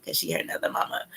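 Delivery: quick hushed whisper. Real, not acted. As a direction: whisper it fast like a secret. Whispered aside about stepmom.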